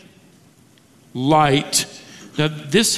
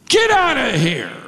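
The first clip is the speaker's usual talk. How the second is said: hostile heckle; 5 semitones higher than usual. Retold or imitated. Demon heckles Jesus.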